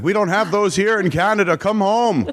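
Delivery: hint of a pleading Canadian mom; imitated insistent mom. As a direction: playfully mimic a mom urging her kid home. Acts out her mom's plea.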